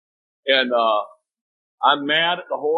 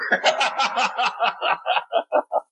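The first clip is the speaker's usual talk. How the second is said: laugh; 9 semitones higher than usual. Real, not acted. Laughs after own joke.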